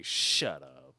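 low, gruff quoted line; movie quote. Retold or imitated. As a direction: growl it like the movie. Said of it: "To echo the movie".

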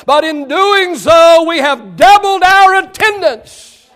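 loud, slow, high proclamation; preaching shout. Real, not acted. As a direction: drive home the victory. Preacher shouts triumphant claim.

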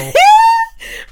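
shrieking laugh, loud; loud high laugh. Real, not acted. Laughing hard at joke.

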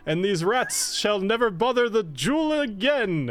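a pompous hero proclaiming victory; triumphant knight. Acted. GM plays triumphant Roland.

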